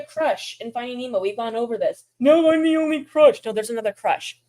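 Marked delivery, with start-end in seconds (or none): 2.2-3.4 higher, louder puppet voice insisting; insistent turtle puppet. Pretend. Turtle puppet arguing back at the host, higher and louder. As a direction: puppet turtle firmly denying it.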